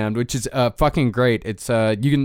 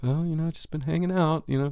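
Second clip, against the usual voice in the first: softer, higher offhand imitation; acted casual reply; 4 semitones higher, 6 dB quieter than usual. Fan answering the band backstage, acted casual reply. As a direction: play it cool with a pal.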